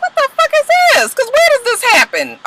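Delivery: high-pitched, fast mock disbelief; incredulous. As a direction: squeal it in comic shock. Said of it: "I was just like".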